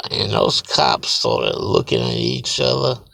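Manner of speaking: usual speaking voice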